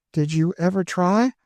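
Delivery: put-on high, anxious girlish pleading; pleading young girl. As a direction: beg her to run away with you. Emmeline's high voice.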